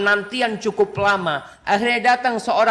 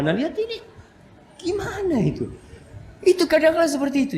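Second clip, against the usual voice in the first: mock shock; 9 semitones higher, faster than usual. Real, not acted.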